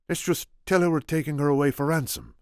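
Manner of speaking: put-on lightly Spanish-accented Inigo; gentle Spanish accent. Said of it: "my attempt at Inigo".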